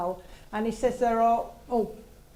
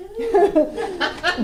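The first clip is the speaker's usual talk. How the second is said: loud, high real laugh; burst of laughter; 10 semitones higher, 6 dB louder than usual. Real, not acted. Medium laughing at the dad's joke, a loud high laugh. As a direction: cracks up at the message. Medium amused by spirit message.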